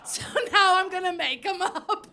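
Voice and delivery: lightly put-on British accent; mock West End British accent. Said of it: "Back to American".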